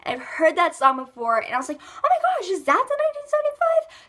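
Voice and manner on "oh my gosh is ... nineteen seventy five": high-pitched, louder astonished question; amazed reaction. Retold or imitated. Vlogger retelling her reaction on recognizing the band, amazed. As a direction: re-enact a squealed discovery.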